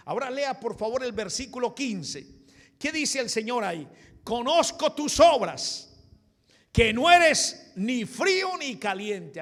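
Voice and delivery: loud, high-pitched preacher's shout; forceful preaching. Acted. Preacher calling for verse fifteen and voicing the Lord's rebuke, shouting. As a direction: thunder the verse out at full force. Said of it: "ahí comienza el Señor a darle fuerte".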